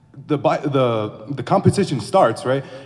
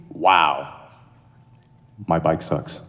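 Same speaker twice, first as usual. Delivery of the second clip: deadpan thought; 11 semitones lower, much slower than usual. Acted.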